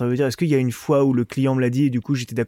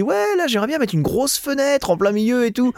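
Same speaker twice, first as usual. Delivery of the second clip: client voice; 8 semitones higher than usual. Retold or imitated. Maçon mimics demanding client.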